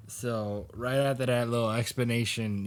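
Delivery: ordinary talk, nothing marked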